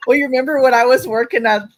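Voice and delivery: ordinary delivery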